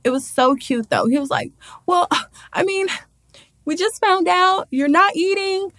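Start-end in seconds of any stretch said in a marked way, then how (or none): 1.9-5.7 high, tentative imitation of worry; all worried. Boyfriend urging her to eat, worried. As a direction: plead gently in a raised pitch. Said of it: "like, all worried".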